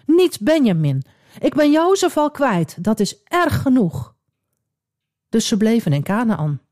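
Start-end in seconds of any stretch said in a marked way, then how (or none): 0.1-4.2 pleading cry. Acted. Father Jacob refusing to lose Benjamin too, high pained plea. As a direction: hold on to the cry, higher. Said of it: "riep hij uit".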